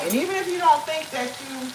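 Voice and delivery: ordinary talk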